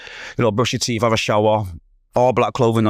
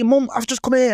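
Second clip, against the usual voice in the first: much higher, pleading complaint; retold emotional protest; 14 semitones higher than usual. Son complaining to his mum, retold emotional protest. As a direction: replay an upset appeal to mum, pitched way up. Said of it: "So now I'm emotional".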